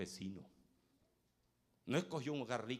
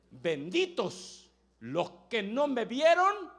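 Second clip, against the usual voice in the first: slow, loud blessing; solemn proclamation; 11 semitones higher, 15 dB louder, much slower than usual. Acted. Jesus proclaiming a blessing on believers, slow and loud. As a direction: proclaim each word slowly.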